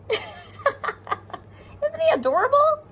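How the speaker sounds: high, slow, laughing coo. Real, not acted. Teacher coos to her pupils over a cute owl.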